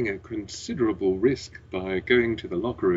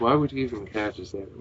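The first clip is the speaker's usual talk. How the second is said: ordinary talk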